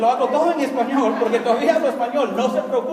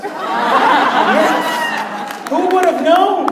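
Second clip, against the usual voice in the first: loud, higher sarcastic cheer; mock triumphant shout; 6 semitones higher, 5 dB louder, much slower than usual. To the crowd, loud sarcastic cheer. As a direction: shout it like it's a shock.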